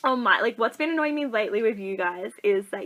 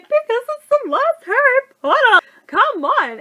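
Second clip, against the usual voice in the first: high, loud, hammed-up wail; wailing protest; 13 semitones higher, 8 dB louder than usual. Crying fan to unmoved boyfriend at last film, wailed protest. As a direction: protest as if overcome, pitch way up.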